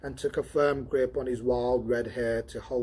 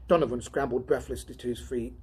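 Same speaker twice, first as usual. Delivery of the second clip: usual speaking voice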